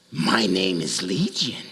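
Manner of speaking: low, slow, ominous demon voice. Acted. Possessed man answering Jesus, low slow demon voice. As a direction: drag it out deep and menacing.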